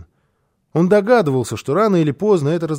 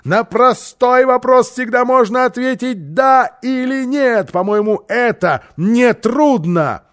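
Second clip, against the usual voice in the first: loud, high, slow emphatic demand; harsh bark; 8 semitones higher, 5 dB louder, slower than usual. A narrator's reading. Housekeeper cutting the boy short, harsh shouted order. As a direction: shout down the excuse, hammering each word. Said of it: "прервала его жёстким окриком".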